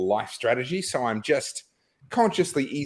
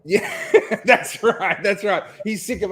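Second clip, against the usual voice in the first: laughing; 8 semitones higher, 5 dB louder than usual. Real, not acted. Laughing agreement.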